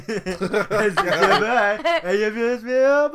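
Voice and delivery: loud, high, laughing through the yells; loud laughing yells of the catchphrase; laughing hype yell. Pretend. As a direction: cackle it out while yelling. Friends riffing on the catchphrase.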